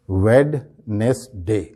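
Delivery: "ویڈنسڈے" slowly as wed... nes... day; spelling pronunciation. Shown as a demonstration. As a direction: sound every syllable and the D in "ویڈنسڈے". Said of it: "عام طور پہ لوگ اس کو اس طرح سے". ("ویڈنسڈے" کو "ویڈ نیس ڈے" توڑ کر)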